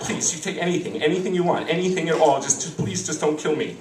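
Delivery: mock-terrified begging, words tumbling and repeating; pleading. Husband begs mugger not to kill him, pleading. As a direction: beg for your life, hammed up.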